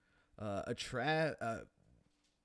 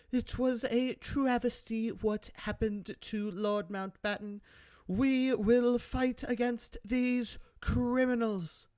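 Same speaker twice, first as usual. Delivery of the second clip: Margaret Thatcher; 11 semitones higher, 4 dB louder than usual. Retold or imitated. Thatcher calling the Queen, high hammed-up stern lecture.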